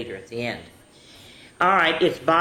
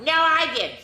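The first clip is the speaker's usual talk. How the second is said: arguing back; 11 semitones higher than usual. Acted. Other kid arguing over the red ball, high protest. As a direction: fire it back like a stubborn kid.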